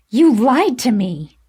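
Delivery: loud, high, slow accusation; yelling. A narrator's reading. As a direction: yell it in a flash of anger. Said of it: "I yelled".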